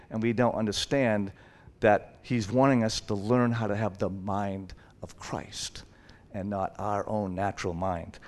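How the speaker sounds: hushed, breathy sermon whisper; whispered truth to the congregation. Real, not acted. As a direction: lean in and whisper it. Intimate aside in sermon.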